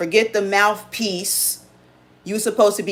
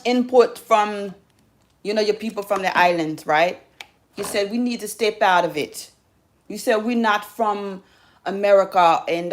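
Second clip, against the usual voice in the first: put-on Jamaican accent; 3 semitones higher than usual. Addressing people from the island in a Jamaican accent. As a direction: speak in a mock Jamaican lilt. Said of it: "how I'm doing on my accent".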